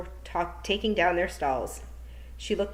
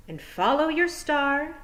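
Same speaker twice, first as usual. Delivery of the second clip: higher, slow, dreamy sing-song; a witch mocking lofty advice, high and slow; mock inspirational; 8 semitones higher, slower than usual. A narrator's reading. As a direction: parody a greeting-card sentiment, lifted and slow. Miss Tick mock-inspirational advice.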